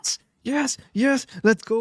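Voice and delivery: high, imitated yelps of joy; mock celebration yell; wild celebration. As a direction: relive the goal like a barking dog. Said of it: "कुत्ते की तरह celebrate किया".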